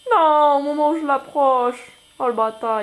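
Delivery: a stretched-out cry of dismay. Real, not acted. Se fait piéger dans le jeu. (cri consterné, lent, plus aigu et plus fort)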